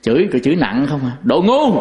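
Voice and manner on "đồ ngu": imitating a wife's insult, raised pitch; mock scolding at a husband, short and higher; retold scolding. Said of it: "bà vợ chửi ông chồng".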